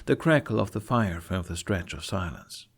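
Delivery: ordinary talk, nothing marked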